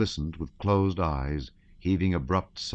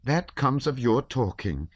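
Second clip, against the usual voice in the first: slow, higher reproachful wife; angry reproach; 7 semitones higher, slower than usual. A narrator's reading. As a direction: scold him, dismayed. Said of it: "turned angrily to her husband".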